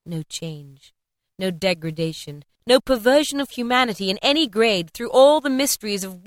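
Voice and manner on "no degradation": usual speaking voice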